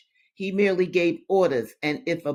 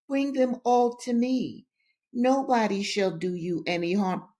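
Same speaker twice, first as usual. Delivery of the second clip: high-pitched, wheedling invitation; insinuating coaxing; 5 semitones higher than usual. A narrator's reading. Captain coaxing Linda to give up letters, insinuating. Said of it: "in an insinuating way".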